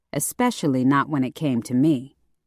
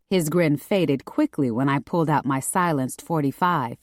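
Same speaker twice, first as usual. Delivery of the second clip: ordinary delivery, nothing marked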